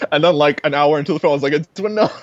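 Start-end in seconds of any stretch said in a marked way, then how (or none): 1.5-2.1 realizing it. Retold or imitated. Sudden recognition of the actress.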